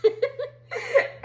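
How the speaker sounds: high, laughing stunned what; laughing mock shock. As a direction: crack up in disbelief. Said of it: "the makeup artist is like".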